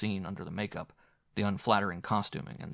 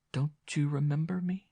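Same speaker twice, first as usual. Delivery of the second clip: wanly; 7 semitones higher than usual. A narrator's reading.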